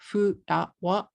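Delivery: "furawa" as three separate Japanese syllables; katakana pronunciation. Shown as a demonstration. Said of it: "If you say flower in katakana".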